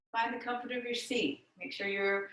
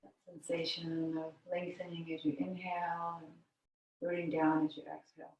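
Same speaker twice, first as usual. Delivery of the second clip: guided meditation; 5 semitones lower, 6 dB quieter than usual. Real, not acted. Yoga teacher guiding students into the breath, meditative. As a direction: lower and quieter, easing them gently into stillness.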